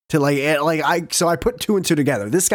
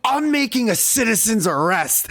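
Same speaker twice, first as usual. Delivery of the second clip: mock official shout; 7 semitones higher than usual. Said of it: "He then goes".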